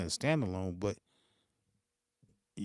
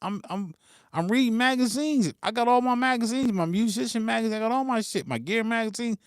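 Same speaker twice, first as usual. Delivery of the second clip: mock innocent reply; 9 semitones higher than usual. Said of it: "I'm like".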